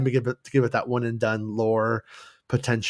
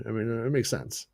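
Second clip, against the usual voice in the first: usual speaking voice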